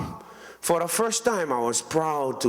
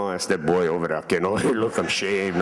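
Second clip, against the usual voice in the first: gossiping aunties; 8 semitones lower than usual. Retold or imitated.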